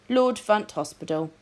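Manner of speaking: ordinary delivery, nothing marked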